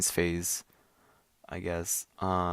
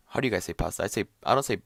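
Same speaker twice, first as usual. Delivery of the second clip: normal talking voice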